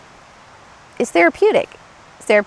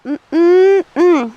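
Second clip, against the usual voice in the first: a mock fretful hum, pitched up; stressed hum; 6 semitones higher, 4 dB louder than usual. Stressed adult grabbing phone, mock fretful hum. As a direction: hum like someone frazzled reaching for the phone.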